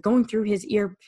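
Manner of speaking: ordinary delivery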